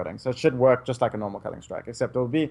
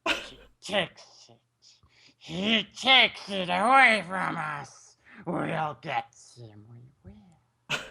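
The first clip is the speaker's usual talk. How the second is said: slow, hushed, scheming creature voice; Gollum impression; 3 semitones higher, much slower than usual. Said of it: "I do Gollum impressions too".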